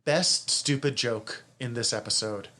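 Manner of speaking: ordinary talk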